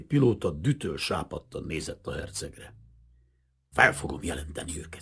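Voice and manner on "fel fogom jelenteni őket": furious whisper through the teeth; hissed threat to the prince. A narrator's reading. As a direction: hiss it under the breath.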